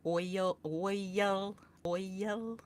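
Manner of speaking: "oil" stretched into two syllables, oi-yul; boyfriend's pronunciation. Retold or imitated. Answering how boyfriend says it, "oil" as oi-yul. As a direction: imitate "oil" as a drawn-out oi-yul. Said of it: "So how does he say oil?".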